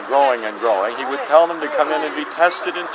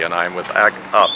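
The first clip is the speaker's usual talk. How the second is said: normal talking voice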